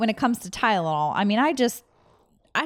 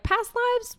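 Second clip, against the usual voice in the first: much higher, disbelieving squeak; incredulous. Retold or imitated. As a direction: scoff in disbelief at "Past lives?". Her own skeptical reaction retold.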